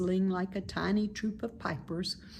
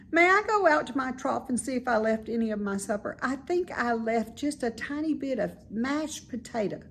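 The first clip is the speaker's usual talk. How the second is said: higher, quick pig voice; eager young pig, pitched up; hopeful little pig; 6 semitones higher, 3 dB louder than usual. Acted. Wilbur voiced higher, fast.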